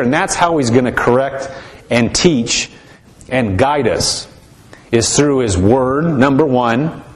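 ordinary talk

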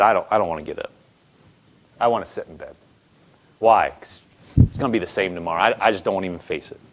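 low, heavy mock-gloomy mumble; gloomy grumbling. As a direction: sound like you can't face the day. Listener voiced as a gloomy spouse.

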